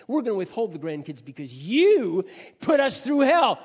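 resentful shout. Retold or imitated. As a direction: throw the grievance back at them. Imagined resentful children confronting parents.

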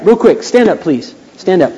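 normal talking voice

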